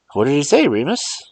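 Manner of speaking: lightly voiced, curious lady; Miss Sally. Miss Sally asks Remus what Mars John said, curious. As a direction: ask the old man what he heard.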